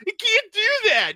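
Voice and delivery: put-on high, loud, drawn-out protest in a boy's thick accent; imitating a disbelieving nephew, thick accent, loud and high; thick young-boy accent. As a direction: yell it like a kid with a thick accent. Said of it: "like, the thickest young boy accent".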